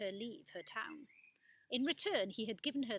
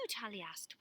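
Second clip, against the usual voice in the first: plain reading voice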